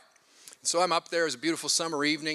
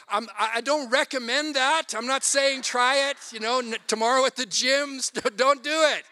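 laughing warning; 6 semitones higher than usual. Real, not acted. Joking warning to congregation.

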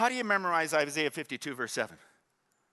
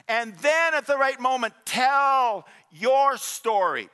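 high, forceful pulpit exhortation; loud, rousing preacher with stretched key words; impassioned preaching; 9 semitones higher, 6 dB louder than usual. Real, not acted. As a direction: drive each point home to the congregation.

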